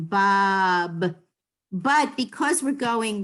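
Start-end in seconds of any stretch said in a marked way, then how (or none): 0.0-1.2 Bob with both b sounds clear; hard b at the start and the end; pronounce both b's. Shown as a demonstration. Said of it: "So you pronounce both of them".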